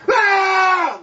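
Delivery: sudden high, drawn-out scream; imitated scream. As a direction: mimic a man screaming out mid-sermon. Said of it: "just screamed out".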